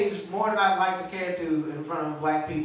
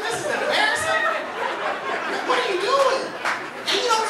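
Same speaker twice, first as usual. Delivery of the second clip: mock embarrassed outburst; 13 semitones higher than usual.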